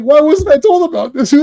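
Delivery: mock indignant commissioner; indignant demand. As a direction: Gordon demanding answers, indignant. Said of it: "he's like".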